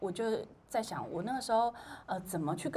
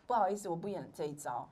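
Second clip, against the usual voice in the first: ordinary delivery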